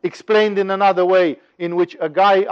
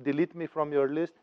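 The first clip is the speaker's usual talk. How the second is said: reasoning rabbit; 8 dB quieter than usual. Acted. Rabbit asks lion to take it off the list, quiet request. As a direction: make a quiet, polite suggestion. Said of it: "the rabbit says".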